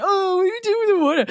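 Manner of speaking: high, fast startled whine; mock alarmed protest. Son woken by dad with water, high quick mock alarm. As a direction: yelp it as the water comes.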